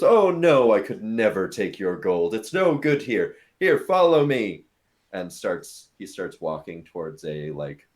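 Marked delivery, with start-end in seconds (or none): 0.0-4.6 high, loud, fussing refusal; effusive bartender. Acted. Bartender refuses the elf's gold, loud and effusive. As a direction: protest grandly, high and loud. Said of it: "the bartender goes".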